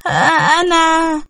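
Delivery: shy stammer. A narrator's reading.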